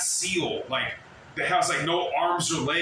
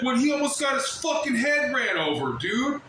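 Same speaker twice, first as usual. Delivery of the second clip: mad rant; 6 semitones higher than usual. Real, not acted. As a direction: vent the anger, voice climbing. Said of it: "It's making me mad".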